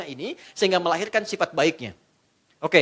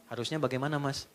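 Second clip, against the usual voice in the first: soft, much lower and quieter; patient wife; 6 semitones lower, 11 dB quieter than usual. Acted. Wife answers her angry husband softly and patiently. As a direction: answer gently, calm and meek.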